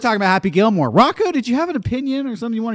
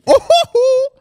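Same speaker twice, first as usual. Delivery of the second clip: high playful teasing host; showman voice; 4 dB louder, slower than usual. Acted. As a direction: coax him in a sing-song voice.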